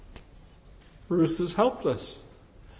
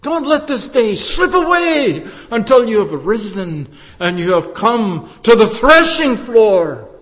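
loud pulpit exhortation with surging pitch; ringing, forceful altar call; impassioned preaching; 4 semitones higher, 8 dB louder than usual. Real, not acted. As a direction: urge the congregation to rise now. Preaches urgent appeal.

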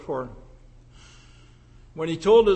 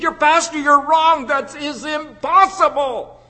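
put-on indignant young woman; 6 semitones higher, 5 dB louder than usual. Young woman objects to pastor.

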